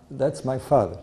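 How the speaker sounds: ordinary delivery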